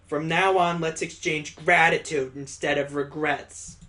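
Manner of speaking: ordinary talk, nothing marked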